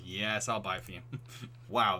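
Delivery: ordinary delivery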